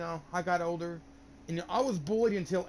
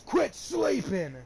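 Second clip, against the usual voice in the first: loud, high, stretched-out command; mock bellow, dragging out the words; retold teacher's yell; 8 semitones higher, 5 dB louder, much slower than usual. Imitating PE teacher scolding a floored student, yelling slowly. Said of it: "P E teacher's yelling at me".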